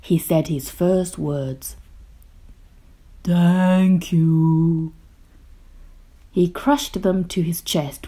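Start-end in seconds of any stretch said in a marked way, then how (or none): 3.2-4.9 slow, loud booming giant; deep, booming monster voice. A narrator's reading. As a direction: boom it out like a big monster. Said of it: "in a deep, booming voice".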